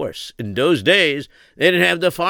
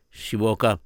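plain reading voice

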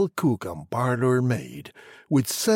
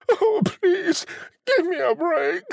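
put-on pleading girl. A narrator's reading. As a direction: beg for mercy in a high voice. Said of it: "She cried".

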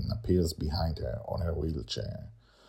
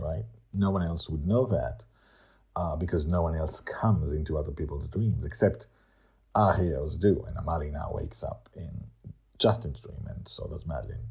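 ordinary delivery

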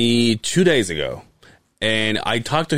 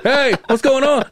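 loud, high-pitched dad greeting; imitating dad; 14 semitones higher, 5 dB louder than usual. Dad badly framed on video call, loud high imitated greeting. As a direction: boom out a high hello as dad. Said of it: "So when he's talking to me".

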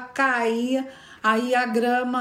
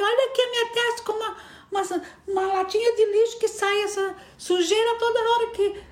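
high, furious, tearful accusations; furious crying girl; 11 semitones higher than usual. Acted. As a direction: scream it at Maria through tears. Bad Maria furious, crying. (menina furiosa, chorosa e aguda)

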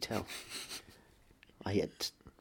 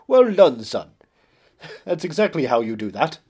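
loud, high, hammed-up posh father; booming proud theatrical dad voice; Patrick Stewart impression; 4 semitones higher, 12 dB louder than usual. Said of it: "and he goes".

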